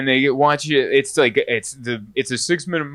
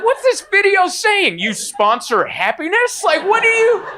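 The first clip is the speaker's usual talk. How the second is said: indignant outburst. Retold or imitated. Watching the ad, he cries out in mock outrage. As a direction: squawk in disbelief at the sponsor.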